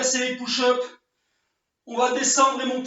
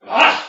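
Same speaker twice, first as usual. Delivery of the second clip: loud strained ah; loud effort cry; 10 dB louder than usual. Real, not acted. Coach finishing the chair hold, loud strained cry. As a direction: let out the burn with a loud cry. Arms burning at end.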